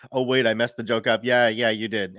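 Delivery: normal talking voice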